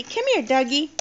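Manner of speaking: put-on high sing-song model phrase for a toddler; reading to a toddler. Shown as a demonstration. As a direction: say it sweetly for the child to copy.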